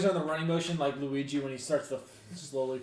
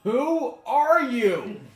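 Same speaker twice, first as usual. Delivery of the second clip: drawn-out, high "who are you"; slow high challenge; 12 semitones higher, 10 dB louder, much slower than usual. Pretend. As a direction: stretch it out, pitched up. Character questions GM.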